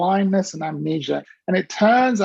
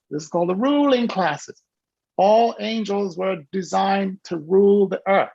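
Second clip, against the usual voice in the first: normal talking voice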